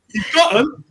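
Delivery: high incredulous question; can't believe it, pitch jumps up; astonished. Retold or imitated. Told the records are safe, asks back in high disbelief. Rocky says he has the records.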